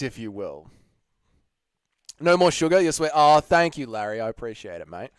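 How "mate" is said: ordinary delivery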